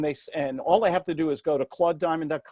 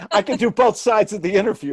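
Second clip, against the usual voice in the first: laughing through the words; joking while laughing; 4 semitones higher, 7 dB louder than usual. Real, not acted. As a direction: laugh out the joke about both sides. Laughing joke about interview.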